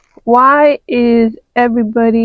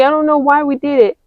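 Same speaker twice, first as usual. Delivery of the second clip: ordinary delivery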